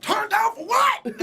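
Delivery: high, loud, slow laughing shout; hammed-up party shout, laughing; shouted catchphrase. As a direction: yell it like a party chant.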